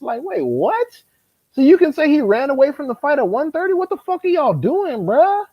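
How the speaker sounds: rising disbelief, voice going way up; incredulous outrage. Real, not acted. Co-host hears pundits' claim, incredulous high outrage.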